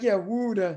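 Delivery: water said as "wooder"; regional pronunciation. Shown as a demonstration. Host shows followers how they say water, as "wooder". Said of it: "W O O O D E R".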